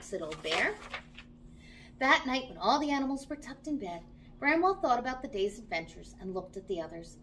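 ordinary delivery

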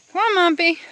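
loud high call to a goat; sing-song call across the field. Real, not acted. Calling goat in.

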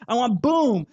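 ordinary talk

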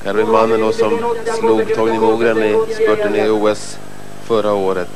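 ordinary delivery

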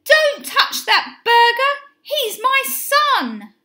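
high, loud protective cry; shout. A narrator's reading. Mum rushes in to save her son, high shout. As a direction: shout it high, stretching the last words. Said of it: "his mum ran in and shouted".

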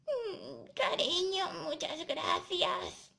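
slow, put-on granny thanks; wolf as fake granny. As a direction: draw out thanks in a feigned granny voice. Wolf thanks her as grandma.

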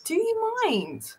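high, slow, offended; drawn-out affronted question; high slow huffy protest; mock indignant. Indignant at cat.

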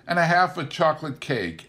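plain reading voice

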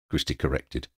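ordinary delivery, nothing marked

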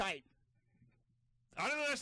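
high sign-off call. Real, not acted. Calling out thanks at segment's end. (高い声で締めの挨拶)

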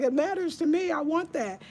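high, emphatic retold protest; insistent retort. To her ex about painting walls, a high insistent protest. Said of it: "I was like".

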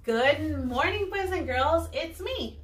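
ordinary talk

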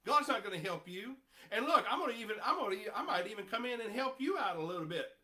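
high, loud taunting enemy; mocking accuser. Preacher voicing Satan jeering at believers, mocking. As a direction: jeer at their failures. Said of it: "he says".

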